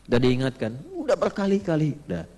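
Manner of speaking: ordinary talk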